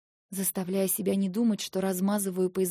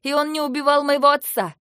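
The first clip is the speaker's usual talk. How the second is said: loud, higher accusing cry; furious shout; 7 semitones higher, 12 dB louder than usual. Acted. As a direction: hurl the accusation at him. Quarrel climax, accusing him. (гневный крик)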